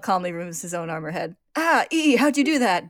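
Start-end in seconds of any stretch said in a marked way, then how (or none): 1.5-2.9 high, very loud comic scream. A narrator's reading. Barry screams at Al's headless armor, shocked. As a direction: shriek it in hammed-up shock. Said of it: "Barry screams".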